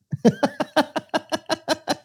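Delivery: squealing high laugh; high squeal of laughter at the joke; high giggle. Real, not acted. Laughing at NBC logo joke.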